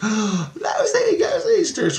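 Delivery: gasp, then very high, loud squeal; drawn-out squeal of a thrilled child; excited little girl. Retold or imitated. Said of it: "And she goes".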